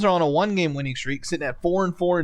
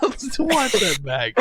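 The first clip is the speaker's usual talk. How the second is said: higher voice at ordinary volume, tailing out of a laugh; amused, laughter easing; 4 semitones higher than usual. Real, not acted. Host repeating to co-hosts about his mishap, still amused. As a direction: say it while recovering from laughing.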